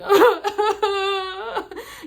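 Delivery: high, loud sobbing wail demo; laugh-cry wail. Shown as a demonstration. Vlogger demonstrating her audible bawling, high laugh-cry wail. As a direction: show how hard you were bawling. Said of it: "you know, like that".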